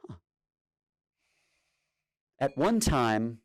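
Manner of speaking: ordinary delivery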